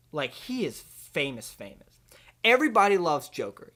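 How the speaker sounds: ordinary talk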